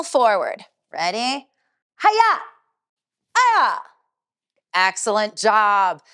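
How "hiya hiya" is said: high, loud battle cry; martial arts kiai. Shown as a demonstration. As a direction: strike with a sharp kiai shout. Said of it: "When I say Hiya".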